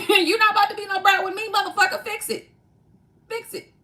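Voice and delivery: high, loud, no-nonsense telling-off; mock stern scolding. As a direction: put the brat in its place. Voicing someone who checks you.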